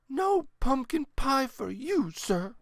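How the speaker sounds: put-on high, slow stern old lady; a grandmother banishing a cheat. A narrator's reading. Grandmother dismisses Horace.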